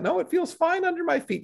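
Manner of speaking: lightly mock his mom brushing off the warning; imitating mom. Son imitating his mom brushing off his warning, high mock voice.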